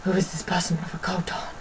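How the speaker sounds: hushed, whispered mock astonishment; imitated onlookers. Voicing staring pupils wondering about coat wearer, hushed whisper. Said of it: "stared at me like".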